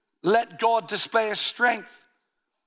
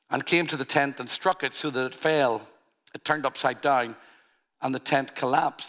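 lower, measured Bible reading; unhurried scripture reading voice; 7 semitones lower than usual. Acted. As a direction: read the passage steadily, a little lower. Reads the Gideon passage aloud.

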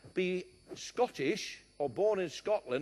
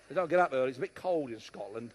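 usual speaking voice

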